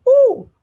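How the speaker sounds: high, loud startled squeal; startled ooh. A narrator's reading. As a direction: jump with a sudden ooh. Startled by teacher's rebuke.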